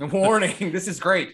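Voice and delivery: loud laughing parody of the tagline. Real, not acted. Mocking the tagline while laughing.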